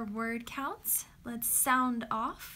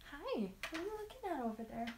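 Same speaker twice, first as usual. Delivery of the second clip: softer dog-directed coo; pet talk; 5 semitones higher, 6 dB quieter, faster than usual. Real, not acted. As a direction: chirp curiously at the dog. Talking to her dog.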